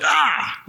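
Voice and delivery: sharp high yelp of pain; a sudden jumpy ouch cry; startled yelp. Retold or imitated. Husband yelping when his wife touches his arm, startled.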